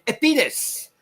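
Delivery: high-pitched mock French girl; French accent. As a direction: answer proudly in a French accent. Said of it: "They said to the French girl".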